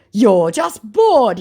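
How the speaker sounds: very slow, loud, high drawn-out scoff; hammed-up bored scammer; mock bored dismissal. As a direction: drag it out like a yawn. Voicing scammer's dismissive reply.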